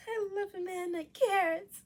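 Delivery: slow, high swooning praise; gushing. Real, not acted. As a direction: gush about him. Gushing comment.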